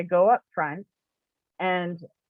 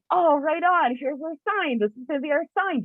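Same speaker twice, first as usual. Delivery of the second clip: hopeful excitement; 7 semitones higher than usual. Retold or imitated. Acts out hopeful reaction to lady.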